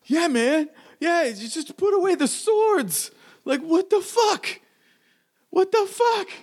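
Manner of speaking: very high, freaked-out voice; panicked surrender. Acted. Surrendering gunman pleads to put away the swords, panicked high voice. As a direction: protest in a strained, panicky squeal.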